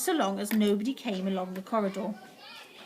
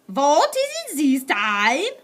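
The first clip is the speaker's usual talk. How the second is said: slow, louder witch shout; 5 semitones higher, 8 dB louder, much slower than usual. A narrator's reading. Witch shouting at the knock, slow and loud. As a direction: bark it out impatiently. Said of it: "shouted the Grand High Witch".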